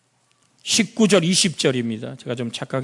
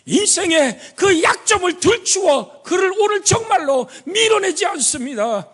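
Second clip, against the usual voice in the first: preaching; 13 semitones higher than usual. Acted. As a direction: drive the point home. Preacher at full force.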